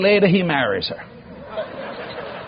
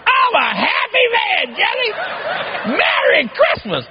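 very high, slow, crowing imitation; excited old father; 14 semitones higher than usual. Father signs off to his son at Christmas, exaggerated glee. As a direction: stretch it out in hammed-up elation. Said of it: "He's excited".

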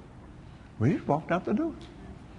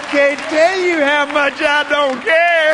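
shouted, slow punchline; mock shout; 12 semitones higher, 8 dB louder, slower than usual. Preacher shouting to the congregation, slow mock bravado. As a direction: belt it out slowly with a grin.

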